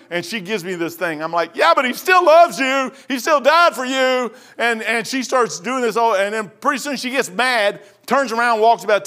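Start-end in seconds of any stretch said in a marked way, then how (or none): 1.6-4.3 loud, high retold comeback with stretched words; insistent street preaching. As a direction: insist on it, calling it out. Answers her curse by preaching louder.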